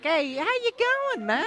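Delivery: high mock Aussie greeting; light Australian accent. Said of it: "Australians say".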